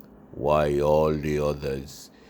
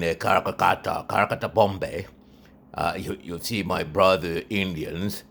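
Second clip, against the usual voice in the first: normal talking voice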